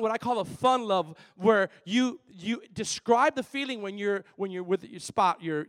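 ordinary talk

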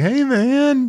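high-pitched, drawn-out stoner greeting; imitating someone high. Kevin Bacon greeting his captors, stoned impression. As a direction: stretch it out in a loopy, high voice. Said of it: "clearly high, basically".

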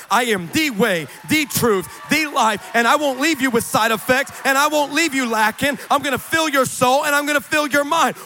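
loud, rising declaration, voicing God; God's voice, preached. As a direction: preach it out forcefully as God's promise.

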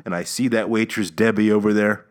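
ordinary talk, nothing marked

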